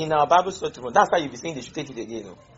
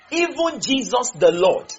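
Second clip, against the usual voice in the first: slow and high, EVEN lifted; emphatic repetition; 6 semitones higher, slower than usual. Shown as a demonstration. Preacher repeating a verse they're not getting, slow and emphatic. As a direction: press home "Even Jesus the Lord", slowly, pitched up. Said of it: "you're not getting it".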